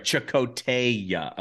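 Chakotaya slowly, stretching the "TAY"; drawn-out name. Shown as a demonstration. Realising where the name comes from, Chakotaya slowly drawn out. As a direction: spell out Chakotaya by sound, lingering on TAY-a. Working out the name's derivation.

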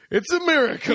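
high, loud mock exclamation of wonder; mock miracle cry. Co-host jokes about the nun's baby, with a loud high mock cry. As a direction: proclaim it like a tongue-in-cheek miracle.

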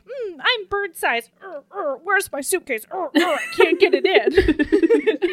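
high, slow little squeaky heroine voice with a laugh; tiny bird voice. Retold or imitated. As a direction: play her small and helpless, giggling. Tiny heroine fumbling with suitcase.

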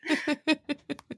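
light high laugh at her own joke; brief amused laugh; real laugh. Real, not acted. Host laughs after joke.

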